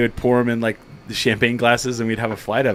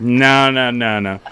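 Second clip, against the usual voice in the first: laughing repeated no, louder; Steve's laughing no, no, no; a loud, laughing refusal in Steve's voice; imitating Steve; 5 dB louder, slower than usual. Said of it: "Steve goes".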